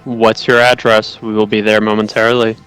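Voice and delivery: text message read-out. Acted. Texting back to ask the address, measured read-out. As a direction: read it out evenly like a formal text. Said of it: "I text back".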